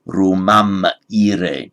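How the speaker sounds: slow, careful Latin: Romam ire; Latin pronunciation. Shown as a demonstration. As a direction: say Romam ire slowly, syllable by syllable. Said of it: "to go to Rome".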